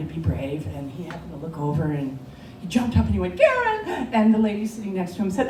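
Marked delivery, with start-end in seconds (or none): 3.4-3.9 a high call across the plane; husband calls his wife's name across the cabin. Retold or imitated. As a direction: yell her name to get her attention.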